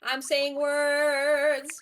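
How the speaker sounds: slow, deep voice changer sound; deep pitch shifted. Pretend. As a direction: talk slowly through a voice changer. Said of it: "Say words".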